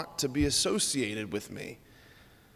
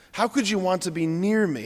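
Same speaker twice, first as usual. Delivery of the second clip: normal talking voice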